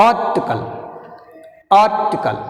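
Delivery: "Article" with forceful AR, then falling; teacher modelling "Article", stressed at the start; first-syllable stress. Shown as a demonstration. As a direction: press on AR, drop the rest of "Article". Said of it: "You pronounce first syllable more force".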